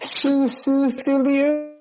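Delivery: high mocking squeak; mocking squeal. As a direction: squeal the name to tease. Mocking the mispronunciation.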